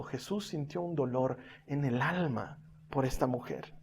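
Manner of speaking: low, hushed pastoral voice; tender solemn preaching. Real, not acted. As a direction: speak softly and intimately to the hurting. Preacher tenderly applies the passage. (predicación íntima, en voz baja y tierna)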